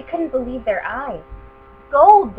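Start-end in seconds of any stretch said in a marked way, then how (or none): 1.9-2.4 loud, high cry of wonder; children gasping out the word; excited cry. Acted. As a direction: shout it in amazement. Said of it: "they cried".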